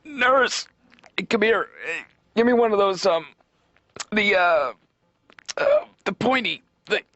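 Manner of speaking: high, spaced-out doctor voice; stoned surgeon. Retold or imitated. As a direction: fumble for the word like a high surgeon. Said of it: "the last thing I'd want to hear".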